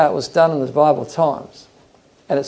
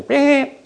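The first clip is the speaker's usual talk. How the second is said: imitated lamb bleat; 12 semitones higher than usual. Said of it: "I came".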